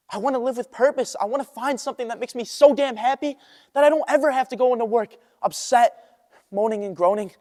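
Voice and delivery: insistent and fired up; impassioned declaration. Acted. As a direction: declare it with rising passion. Impassioned monologue about wanting purpose.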